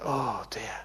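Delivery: soft whispered dismay; whispered oh dear. Retold or imitated. Preacher whispering dismay at the crying habit. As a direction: sigh it out under your breath.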